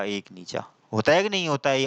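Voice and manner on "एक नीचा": नीचा dropped low and hushed; low, soft नीचा; low note. Shown as a demonstration. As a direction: let नीचा fall to the bottom step.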